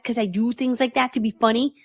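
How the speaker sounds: normal talking voice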